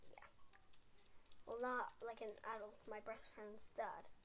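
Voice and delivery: usual speaking voice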